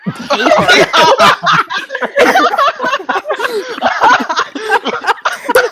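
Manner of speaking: shrieking laugh, high and loud; high wheezing laugh. Real, not acted. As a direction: cackle in disbelief. Everyone threw rock.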